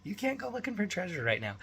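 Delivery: a hint of a high, fast mock-reasoning voice; lightly voiced parent correcting a kid; dad explaining the rules to his son; patient dad.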